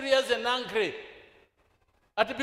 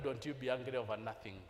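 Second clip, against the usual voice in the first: much softer, lower advice to a friend; quiet aside; 12 semitones lower, 15 dB quieter than usual. Acted. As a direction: drop to a hush and lower the pitch.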